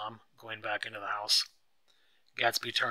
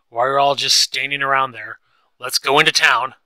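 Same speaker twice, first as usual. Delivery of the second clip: loud, raised, impatient shouting; angry yelling; 3 semitones higher, 13 dB louder than usual. A narrator's reading. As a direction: demand it, fed up and loud. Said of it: "Tom started to get angry".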